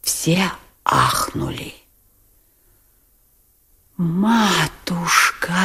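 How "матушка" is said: drawn-out anguished wail of Матушка; wailing cry. A narrator's reading. Lebedev wailing at the burning money. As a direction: wail it out slowly, near tears. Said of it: "всё ещё вопил Лебедев".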